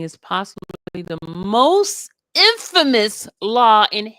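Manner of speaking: loud, declamatory reading of a lawyer; emphatic court argument read-aloud. Retold or imitated. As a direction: read it like a closing plea to the court. Reads a lawyer's closing argument aloud.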